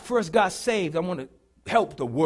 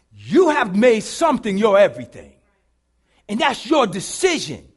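louder, slow preaching close; 4 semitones higher, 12 dB louder than usual. Acted. Preacher applying the lesson to the congregation, loud. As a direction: drive the lesson home to the church.

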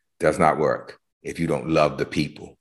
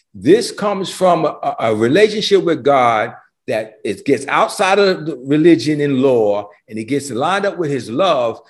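high, loud, driving sermon voice; full-force preaching; 8 semitones higher, 3 dB louder than usual. Acted. Pastor pressing the point on his congregation, full-force preaching. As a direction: preach it out with rising fire.

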